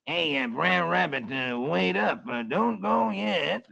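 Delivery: lightly voiced, slow buzzard calling after him; a hint of a pleading buzzard; slow, hesitant buzzard calling. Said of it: "as Brer Buzzard calls".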